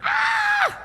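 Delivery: one short scream, pitched right up and loud; a scream aimed at the listener; demo scream. Shown as a demonstration. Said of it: "even if I'm screaming at you".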